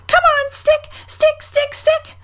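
very high squealed cheer; Roo calling out. A narrator's reading. Roo cheers on his stick, high squeal. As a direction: urge it on in a tiny squeaky voice. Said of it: "calling out".

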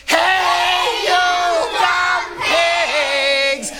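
huge high-pitched, drawn-out holler; full yell. A narrator's reading. Girl yells at pigs from the opened door, full holler. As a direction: yell it at the top of your voice, stretching each word. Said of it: "opened it up all the way and yelled".

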